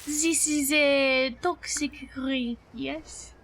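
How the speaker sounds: hammed-up, mock French accent; horrible French accent. Streamer playing up a horrible accent redeem for chat, hammed-up French accent. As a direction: put on a deliberately bad French accent. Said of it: "It wouldn't be French".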